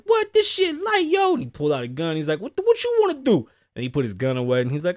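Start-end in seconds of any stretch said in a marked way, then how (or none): 0.0-1.4 much higher and louder, hyped up; imitating excited fan. Vlogger acts out the fan taunting Mike Tyson, high excited squeal. Acting rowdy fan's reaction. 2.4-3.5 much higher, louder squawk; imitating rowdy fan. Fan challenges Mike Tyson after pulling a gun, high mock squawk.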